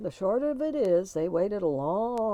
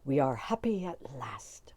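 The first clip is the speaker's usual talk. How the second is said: whispered, hushed and content; soft contentment; 6 semitones lower, 3 dB quieter than usual. A narrator's reading. Tim whispering contentedly to his wife at last. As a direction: breathe it out in relief. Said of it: "said Tim".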